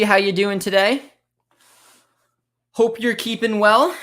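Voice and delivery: ordinary delivery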